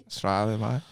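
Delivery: put-on Australian; Australian accent. Shown as a demonstration. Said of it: "He's Australian".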